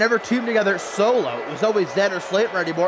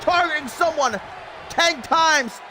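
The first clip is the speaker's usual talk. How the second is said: excited play-by-play; 7 semitones higher, slower than usual. Acted. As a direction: call the action like a ringside announcer.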